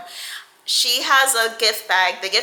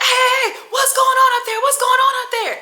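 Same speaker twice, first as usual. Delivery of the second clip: much higher, louder calling voice; imitating grandma calling; 4 dB louder, faster than usual. Grandma calling upstairs at the fight, high. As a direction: call up the stairs in a high voice. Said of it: "And they're like".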